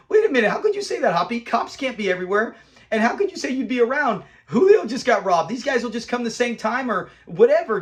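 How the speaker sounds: retold teenage objection, indignant and high; indignant challenge. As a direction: push back in mock indignation, jumping up in pitch. Retold indignant reaction to Hoppy's promise.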